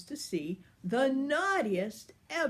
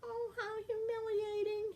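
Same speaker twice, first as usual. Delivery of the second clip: much higher, soft little cat voice; meowing cat; 14 semitones higher, 7 dB quieter, slower than usual. Acted. The shrunken lion moans in a tiny high cat voice. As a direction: squeak it out like a tiny scared cat. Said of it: "he meowed".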